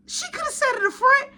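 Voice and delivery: high-pitched, incredulous complaint; exasperated protest. Retold or imitated. Son complaining about mom climbing in back, high exasperated protest. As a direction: squeal it in exasperation.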